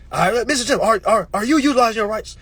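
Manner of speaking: stern interrogator. Acted. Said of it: "cartoon court show".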